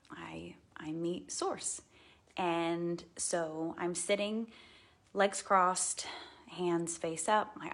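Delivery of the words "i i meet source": slow, hushed whisper; whispered awe at meeting source; reverent whisper. Real, not acted. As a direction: breathe it in awe.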